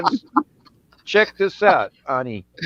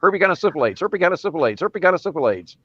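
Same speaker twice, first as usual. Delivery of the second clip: quick-fire repeats, running straight into each other; three times fast; faster than usual. Shown as a demonstration. Showing off a practiced made-up word to doubting friends, three times fast. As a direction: rattle off the tongue-twister without a gap. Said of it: "say it three times fast".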